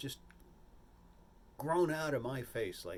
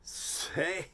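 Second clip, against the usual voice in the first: director's voice; 4 semitones higher, much slower than usual. Retold or imitated. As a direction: lay out each word like a theatrical director. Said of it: "He says".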